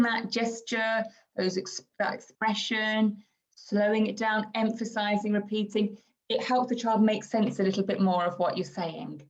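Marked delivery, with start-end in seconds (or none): none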